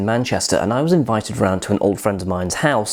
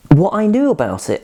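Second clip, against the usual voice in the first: ordinary delivery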